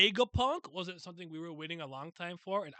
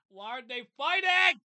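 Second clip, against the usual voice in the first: high, much louder cry of disbelief; baffled outburst; 7 semitones higher, 10 dB louder, slower than usual. Real, not acted. Streamer baffled by the manga fight, loud exasperated cry. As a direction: throw the question out in exasperation.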